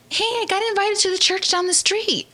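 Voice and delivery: high, bright sister; sister's voice. Retold or imitated. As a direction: chirp out the invite. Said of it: "my sister's like".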